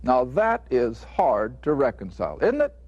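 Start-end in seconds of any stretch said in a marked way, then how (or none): 2.4-2.7 rhetorical question. Real, not acted. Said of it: "rhetorical question to audience".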